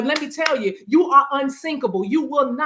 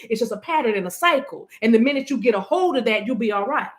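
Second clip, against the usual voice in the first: ordinary delivery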